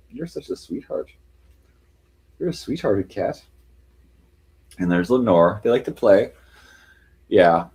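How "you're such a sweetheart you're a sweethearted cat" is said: pet talk. Real, not acted. Cooing to her cat.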